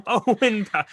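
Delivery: laughing. Real, not acted.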